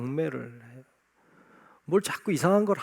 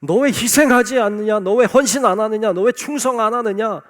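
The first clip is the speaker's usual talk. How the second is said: rising loud rebukes, higher and louder; rebuking; 5 semitones higher, 10 dB louder than usual. Retold or imitated. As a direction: fire off each question as a scolding. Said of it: "이렇게 책망하지 않았어요". (높고 큰 소리로 꾸짖듯이)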